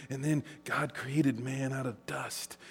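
soft, slow and hushed; Sunday school teacher telling creation to children, hushed; hushed storytelling. Retold or imitated. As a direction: lean in and tell it softly. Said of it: "and he says".

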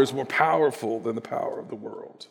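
whisper. Real, not acted. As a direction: drop to a hushed whisper. Whispered after climax.

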